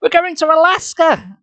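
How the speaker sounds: high, drawn-out cheer; excited shout. A narrator's reading. Jordan shouting about the Alaska trip, excited. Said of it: "I shouted".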